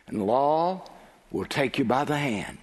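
ordinary talk

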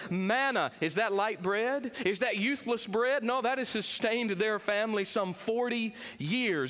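ordinary talk

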